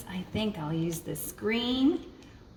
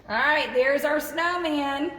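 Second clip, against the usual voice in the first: higher and louder, proud announcement; excited reveal; 6 semitones higher, 4 dB louder than usual. Real, not acted. Presenting the finished snowman to the children, excited.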